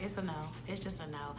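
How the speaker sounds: low, soft refusal; understated, dropped-voice no; quiet dismissal. Retold or imitated. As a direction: turn them down quietly and with finality. Retold calm dismissal of his advances.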